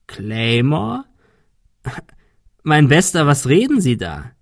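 scornful hoarse villain, slow and mocking; lightly voiced hoarse villain, mocking. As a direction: sneer it out like a bitter old man. Villain mocks detective.

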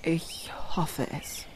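slow, hushed, almost whispered admission; quiet, tentative confession; subdued hope. Acted. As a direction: let it out softly and slowly. Hesitant confession of hope after outburst.

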